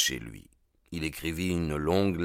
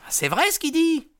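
put-on much higher, eager young voice; young man agreeing; 5 dB louder than usual. Young gang member siding with McMurdo, eager high agreement. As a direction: chime in like a nervous youngster.

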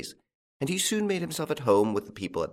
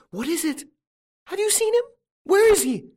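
put-on anxious old woman; 6 dB louder than usual. A narrator's reading. Old wife clasps hands, anxious.

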